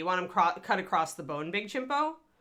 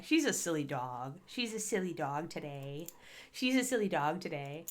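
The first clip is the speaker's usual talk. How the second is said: softer, lower fond murmur; tender to dog; 3 semitones lower, 6 dB quieter than usual. Real, not acted. Fond remarks to her dog.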